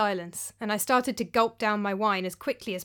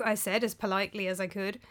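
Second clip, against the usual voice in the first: ordinary delivery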